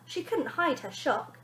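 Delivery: ordinary delivery, nothing marked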